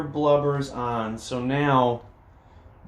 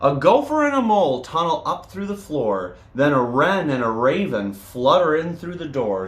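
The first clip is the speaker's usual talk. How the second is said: lively read-aloud with bouncy rhythm; storybook reading. A narrator's reading. Reading a picture book to children, lively storytelling. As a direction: read it to the kids with a sing-song lilt.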